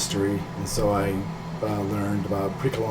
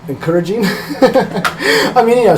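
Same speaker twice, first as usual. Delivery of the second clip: breaking into a laugh, higher and louder; 12 semitones higher, 10 dB louder, slower than usual. Real, not acted. Artist recalls mom was actually encouraging, laughing. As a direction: say it through a burst of laughter. Amused telling of mom's reaction.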